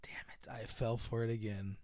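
whispered self-reproach; muttered whisper. Retold or imitated. Girl realizing she was conned, whispered. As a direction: hiss it at yourself. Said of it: "short-tempered".

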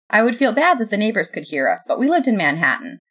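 usual speaking voice

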